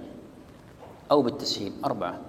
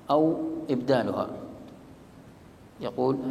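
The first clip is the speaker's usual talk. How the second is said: normal talking voice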